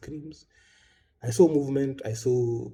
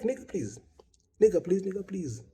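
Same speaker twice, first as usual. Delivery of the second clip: high-pitched, incredulous brush-off; exasperated dismissal; 8 semitones higher than usual. Acted. Bereaved speaker answering boastful mourners, high exasperated dismissal. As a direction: wave them off in a high, pleading scoff.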